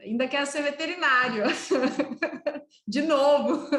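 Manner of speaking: amused, laughing delivery. Real, not acted. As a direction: say it as a joke and break into laughter. Joking imagined request, laughs.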